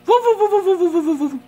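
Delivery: high, loud playful barking; dog barks. Pretend. Man barking at his fish tank in a high playful yip. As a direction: bark like a little dog, way up high.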